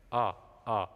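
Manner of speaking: ordinary talk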